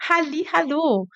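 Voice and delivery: put-on "Halli, hallo" sung out high and cute, like to a child; cute singsong. Shown as a demonstration. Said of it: "um jeito mais fofo de falar".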